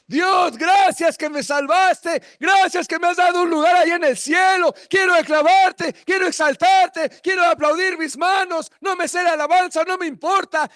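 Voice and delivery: loud, high shouted praise; the Spirit praising. Acted. The Spirit shouting grateful praise to God, loud and high. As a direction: cry out thanks to God at full voice. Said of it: "¿sabes qué dice el Espíritu?".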